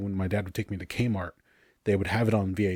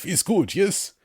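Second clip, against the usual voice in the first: put-on quick higher-pitched Russian accent; light mock Russian accent, pitched up; Colossus accent; 10 semitones higher, 4 dB louder, slower than usual. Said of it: "sorry for the accent".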